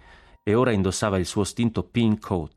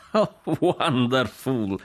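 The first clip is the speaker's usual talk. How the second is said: slow, high, drawn-out delight; delighted cry; 6 semitones higher, much slower than usual. Acted. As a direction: relish every syllable of wonderful. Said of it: "gridò Jack".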